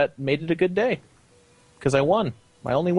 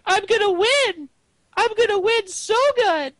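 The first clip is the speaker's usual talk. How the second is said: Lumpy Space Princess; 3 dB louder than usual. Retold or imitated. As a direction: pitch way up like a cartoon princess. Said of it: "Was that the Lumpy Space Princess voice?".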